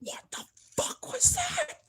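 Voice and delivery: real hushed alarm. Real, not acted. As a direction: squeak it out in held-back shock. Startled again, voice held back.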